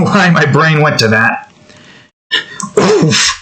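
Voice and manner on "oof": high oof. Pretend. Mock pain sound.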